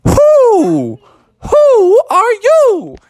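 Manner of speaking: high, loud, slow hooting owl. Acted. The owl demanding who landed in its home, slow high hoot. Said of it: "the owl said".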